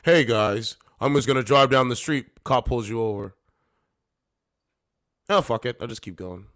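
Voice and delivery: loud, breezy mocking of an entitled driver; mocking entitled women who ignore police; mock entitled woman. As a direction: shrug it off, loud and careless. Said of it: "They literally believe this".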